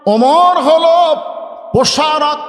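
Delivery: প্রসারক hammered high and loud after a slow Omar হলো; slow, loud, high emphasis on প্রসারক; stress on প্রসারক. Shown as a demonstration. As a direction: drag out Omar হলো, then hit প্রসারক. Preacher contrasts প্রচারক and প্রসারক.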